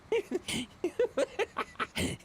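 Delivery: bursting, high-pitched laugh; real amused laughter. Real, not acted. Laughs at chat's joke.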